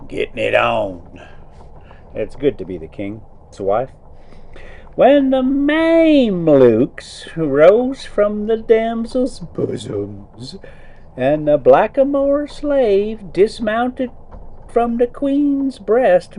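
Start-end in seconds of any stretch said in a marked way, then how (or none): 5.0-16.4 put-on reading the old tale aloud, a touch storyteller; story narration. A narrator's reading. Trucker reading to his viewers while driving, story narration. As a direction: read it like a bedtime book. Reads Arabian Nights aloud.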